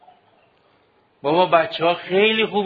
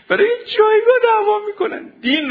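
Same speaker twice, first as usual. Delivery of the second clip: much higher, louder, voice breaking into tears; crying out; 4 dB louder than usual. Real, not acted. Preacher grieving to students over fights for rank, crying. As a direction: cry it out in real anguish.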